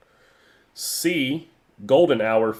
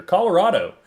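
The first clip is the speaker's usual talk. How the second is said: excited announcement; 8 semitones higher, 6 dB louder than usual. Acted. Host announces destination.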